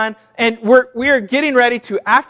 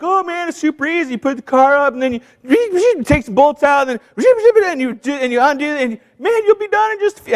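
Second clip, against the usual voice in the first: mocking YouTube guys; 5 semitones higher than usual. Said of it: "and they're like".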